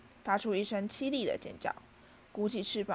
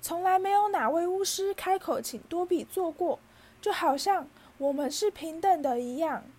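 high, loud, overwhelmed elf voice; choked-up wail; 8 semitones higher, 5 dB louder than usual. A narrator's reading. House-elf never treated as an equal by wizards, tearfully wailing. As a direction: pour it out high, fighting back tears. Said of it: "小精灵强忍住呜音说".

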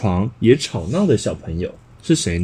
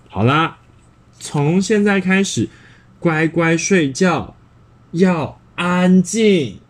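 louder, slow, firm mother voice; mother ordering bedtime; 4 semitones higher, 5 dB louder, slower than usual. Acted. Mother firmly sending Dongdong to sleep. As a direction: lay down the rule slowly to a child.

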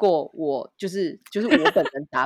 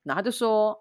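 ordinary talk

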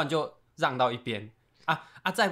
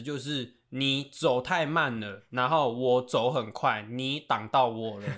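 slow and pointed; 3 semitones lower, much slower than usual. Real, not acted. Spelling out a point slowly.

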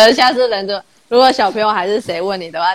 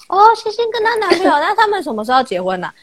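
higher, teasing in-law voice; imitating in-laws; 8 semitones higher than usual. In-laws teasing about marriage, mock nosy voice. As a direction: mimic the nosy in-laws asking. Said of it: "然后他们就会说".

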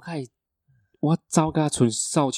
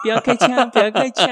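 much higher, louder, pleading; 人质假装求饶; mock pleading hostage; 11 semitones higher, 6 dB louder than usual. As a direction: play the trembling hostage begging. Hostage voice begging not to shoot.

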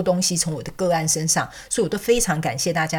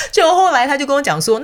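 laughing to tears; 9 semitones higher, 6 dB louder than usual. Real, not acted.

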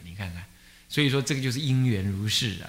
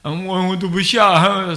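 reluctant student; 5 semitones higher, 8 dB louder, slower than usual. Retold or imitated. Student asking teacher for leave.

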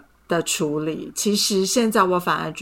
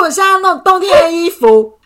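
higher, louder defiant demand; reenacting picking a fight, higher and louder; 10 semitones higher, 10 dB louder than usual. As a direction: insist just to pick a fight. Provoking boyfriend in retold fight.

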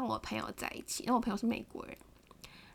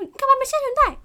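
high, loud angry yell; scolding yell; 14 semitones higher, 12 dB louder, faster than usual. Retold or imitated. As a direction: bark it at her like a scolding. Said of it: "然后就开始骂我".

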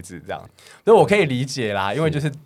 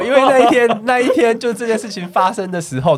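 usual speaking voice

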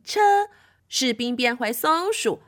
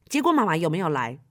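usual speaking voice

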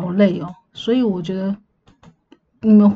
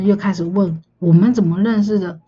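ordinary talk

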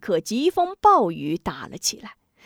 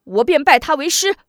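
louder, higher, curt snap; grumpy retort; 6 semitones higher, 7 dB louder than usual. Acted. Prince annoyed at the emperor, answering loud and curt. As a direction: bite out the promise in a huff. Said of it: "没好气道".

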